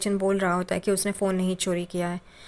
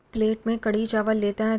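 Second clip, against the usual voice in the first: plain reading voice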